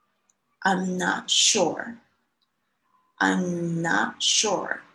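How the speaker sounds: very slow, word by word; I'm not sure spelled out slowly for learners; slow model sentence. Shown as a demonstration. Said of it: "在前面加上一句". (很慢地示范I'm not sure)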